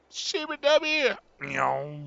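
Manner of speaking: loud high squeal into a falling whine; high-pitched made-up noise, drawn out slowly; playful imitated noise. Gamer imagining a truck backing up, playful imitated noises.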